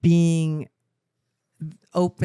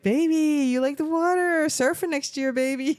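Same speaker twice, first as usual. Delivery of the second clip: high, sing-song cooing at a newborn; doting baby talk; 11 semitones higher, slower than usual. Retold or imitated. Grandma imitating her son cooing to his baby in the bath, high doting baby talk. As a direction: coo it like a proud new dad at bath time.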